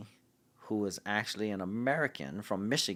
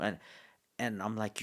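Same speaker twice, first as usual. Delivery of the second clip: normal talking voice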